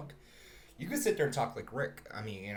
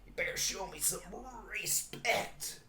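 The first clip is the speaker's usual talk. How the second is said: drunken, higher put-on cartoon voice; Rick; 7 semitones higher, 4 dB quieter than usual. Actor doing his drunk Rick voice to demand respect. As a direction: slur it out like a burping drunk. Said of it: "he's, like, doing Rick impressions".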